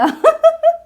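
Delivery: real laugh bursting out high and loud; loud high burst of laughter. Real, not acted. Teacher amused by her own slip, laughing loudly. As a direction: let a big high laugh escape. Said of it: "Eu ia dizer uma alemona".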